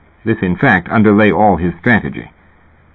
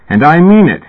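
loud, higher shout of admonition; yelling; 8 semitones higher, 5 dB louder than usual. A narrator's reading. Therapist yelling his warning at Fat, loud shout. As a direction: bark it at him, meaning every word. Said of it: "He yelled at Fat".

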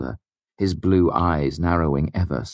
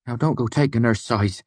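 complaining; 6 semitones higher than usual. A narrator's reading. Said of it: "he complained".

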